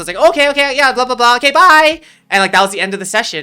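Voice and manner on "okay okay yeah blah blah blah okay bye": high, loud, hurried goodbye act; flustered brush-off. Retold or imitated. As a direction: imitate yourself bailing out of an awkward call. Retold hasty exit from embarrassing call.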